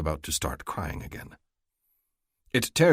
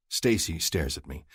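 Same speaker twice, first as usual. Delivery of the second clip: ordinary delivery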